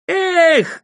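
loud, high cry of frustration; exasperated high yell; vexed shout. A narrator's reading. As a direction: shout it out in vexation. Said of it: "кричал он".

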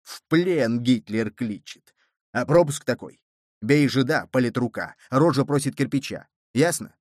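forceful, pressed bass voice; angry bass. A narrator's reading. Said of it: "С нажимом на басы и с какой-то гневной верой".

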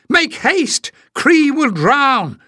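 high, pleading and urgent, slow on the last words; desperate cry. A narrator's reading. Jasmine begs Leif to hurry and save Cree, desperately. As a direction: beg them to hurry before the bird drowns. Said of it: "she cried".

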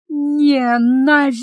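slow, drawn-out hatred through clenched teeth; through gritted teeth. A narrator's reading. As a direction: grind the word out through your teeth. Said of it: "процедила сквозь зубы".

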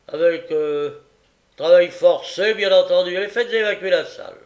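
loud, high orders; imperious sentencing. A narrator's reading. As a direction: bark out the sentence. Magistrate sentences and clears court.

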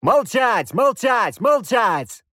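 loud, high furious shout; yell at the top of lungs. Acted. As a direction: bark it to silence the kids. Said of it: "заорал я во всю глотку". (громкий яростный крик)

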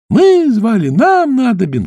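high loud mermaid cry; shout. Acted. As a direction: shout eagerly. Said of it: "кричали русалки". (громкий крик русалок)

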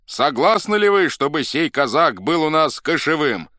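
high, loud public question; proclaiming. A narrator's reading. Escorts seek the assembly's consent, proclaiming loudly. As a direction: put it to all the people. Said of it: "провозгласили во весь народ".

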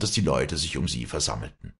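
ordinary delivery

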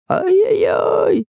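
high drawn-out cry of pain; pained yelp. A narrator's reading. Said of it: "закричал купец".

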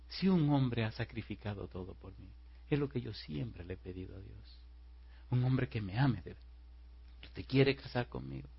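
soft, mildly tearful retold plea; tearful sincerity. Crying woman proposes to the man, soft and sincere. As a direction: speak it softly, from the heart. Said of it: "ella empezó a llorar".

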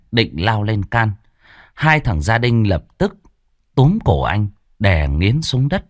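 ordinary delivery

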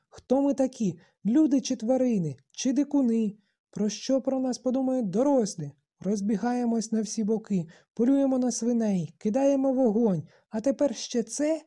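high, loud indignant tirade; piercing outcry. A narrator's reading. Boy berating the assembly for running wild, shrill outcry. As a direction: fire off outraged questions at a squeal. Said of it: "голос трохи пронизливо заверещав".

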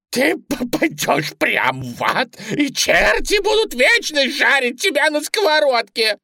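high, loud damning shriek; furious squealed threat; vengeful rabbit. A narrator's reading. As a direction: curse her to hell as the bunny. Bunny damns Suzy.

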